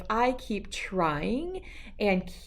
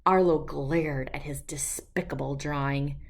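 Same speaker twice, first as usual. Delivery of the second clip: low, dark whisper; 6 semitones lower than usual. A narrator's reading. Narrator whispering Arlo's glare at the drawing. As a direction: hiss it like a secret grudge.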